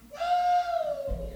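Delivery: high, loud "woo"; excited whoop. Real, not acted. Preacher whooping to the congregation after a chicken joke, high and loud. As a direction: let out a sudden high whoop.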